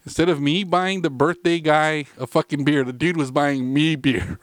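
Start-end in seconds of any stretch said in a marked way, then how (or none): none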